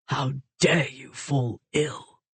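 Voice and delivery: slow, low, rough reproach; slow husky murmur at her bedside. Acted. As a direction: gruffly scold her out of worry. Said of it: "His voice was low and rough".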